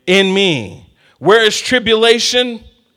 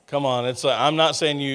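normal talking voice

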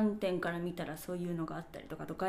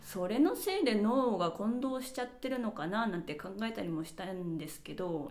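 ordinary talk

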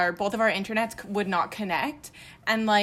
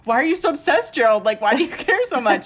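higher and louder, a retold exasperated yell at him; exasperated outburst; 4 semitones higher, 9 dB louder than usual. Retelling how she yelled at her boyfriend over his joke, exasperated loud outburst. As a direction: throw up your hands and shout his name in disbelief.

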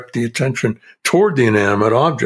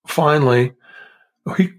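ordinary talk, nothing marked

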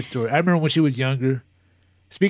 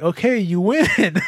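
laughing through "okay, you win"; laughing surrender; 8 semitones higher, slower than usual. Retold or imitated. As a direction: concede with a tearful laugh. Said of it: "I was like".

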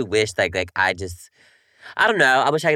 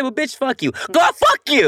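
high yell building louder; retold drunken yelling; 12 semitones higher, 5 dB louder than usual. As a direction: re-enact yelling at a friend. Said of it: "I was drunk and I was yelling at you".

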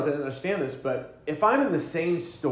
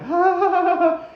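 high, loud overwhelmed yelp; exasperated cry; 8 dB louder than usual. Retold or imitated. Husband overwhelmed by wife's checklist, a high flustered yelp.

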